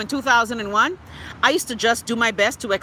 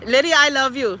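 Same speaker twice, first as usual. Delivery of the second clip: ordinary delivery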